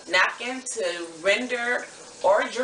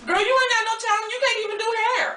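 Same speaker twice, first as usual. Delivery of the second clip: sassy cousin voice. Acted. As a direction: play a brash cousin from the Bay. Plays the cousin character.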